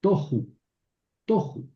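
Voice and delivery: tohu said clearly; careful tohu; Hebrew word demo. Shown as a demonstration. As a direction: model tohu for the class. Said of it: "se convierte en shurek".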